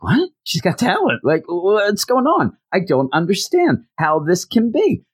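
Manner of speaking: high-pitched baffled reaction; hammed-up squeaky bewilderment; mock disbelief. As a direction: act dumbfounded, pitch climbing. Stunned character acted in retelling.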